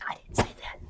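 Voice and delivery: breathy whispered aside; under-the-breath denial. Real, not acted. Aside denying a remark.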